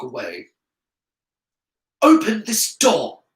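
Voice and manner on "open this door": high, loud, slow command; raised boyish shout of command; angry demand. A narrator's reading. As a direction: demand it forcefully, each word punched. Trapped pupil demands the door opened.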